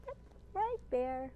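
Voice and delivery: plain reading voice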